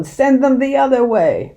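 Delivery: high, loud angry order; bellowed. A narrator's reading. Mister Hazel bellowing orders at the sergeant, loud and angry. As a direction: bark the command furiously. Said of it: "Mister Hazel bellowed".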